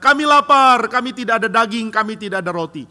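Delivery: high, loud whining complaint; loud, high protest fading down; hungry crowd complaining. Retold or imitated. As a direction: cry out like a starving crowd. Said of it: "Waktu orang Israel mengatakan".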